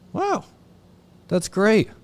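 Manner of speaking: high, bright mock delight over bad news; cheerful sponsor. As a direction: gush like it's great news. Sponsor cheerfully welcomes relapse.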